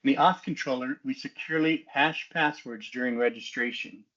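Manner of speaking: ordinary talk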